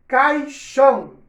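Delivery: Caixão drawn out slow and loud; loud, slow model word Caixão; stretched syllables. Shown as a demonstration. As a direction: spell out Caixão slowly, syllable by syllable. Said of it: "Aí escrevo lá bonitinho, caixão".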